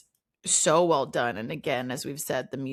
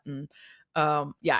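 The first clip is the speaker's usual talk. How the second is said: ordinary talk, nothing marked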